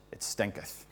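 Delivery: hushed unvoiced whisper, "stinketh" breathed; whispered "It stinketh" at the fridge; whispered warning. Fridge joke.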